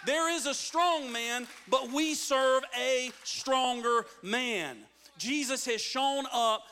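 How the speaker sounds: loud, slowing pulpit declaration; forceful preaching. Real, not acted. Preacher proclaiming the stronger man has come, loud and emphatic.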